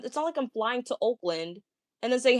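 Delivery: ordinary talk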